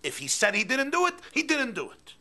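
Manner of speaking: loud, high emphatic defence; the teacher insisting the boy is innocent. Acted. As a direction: back the boy up firmly.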